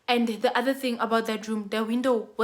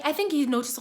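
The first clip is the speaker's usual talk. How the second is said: ordinary talk, nothing marked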